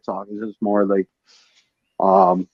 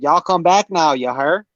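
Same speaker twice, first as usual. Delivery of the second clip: Texas drawl; 5 semitones higher than usual. Retold or imitated. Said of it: "Texas, they'll be like".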